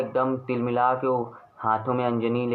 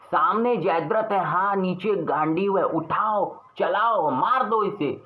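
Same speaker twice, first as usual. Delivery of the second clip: higher, urgent commanding voice, the last order slow; urgent Krishna; 7 semitones higher than usual. Acted. Krishna urges Arjun to shoot Jayadrath, high and urgent. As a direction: urge Arjun to grab the bow and shoot. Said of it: "Shri Krishna बोलते हैं".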